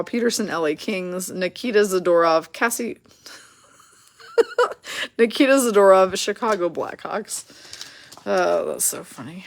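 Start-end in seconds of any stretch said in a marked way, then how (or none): 4.4-5.1 squeaky burst of laughter; a high giggle breaks out; sudden high-pitched laugh. Real, not acted. Laughing at her own mistake.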